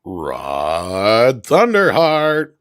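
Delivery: loud, hugely drawn-out announcer bellow; showy name call. Pretend. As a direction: belt it out slowly like a ring announcer. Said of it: "you have to say the name".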